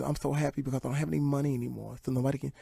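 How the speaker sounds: quick near-whispered soft voice of Tyson; soft, fast whisper. Retold or imitated. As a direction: hush it and speed through.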